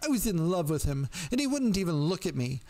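put-on high-pitched distraught girl's confession; pitiful bleat. A narrator's reading. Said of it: "the girl bleated pit-, pitifully".

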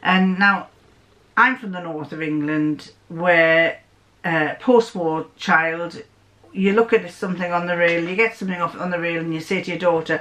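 normal talking voice

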